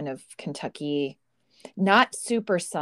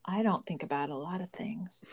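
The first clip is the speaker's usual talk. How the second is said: whisper; 8 dB quieter than usual. Real, not acted. Self-deprecating joke.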